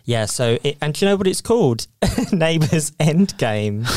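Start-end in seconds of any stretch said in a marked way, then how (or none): 2.1-4.0 slow laughing title reveal. Real, not acted.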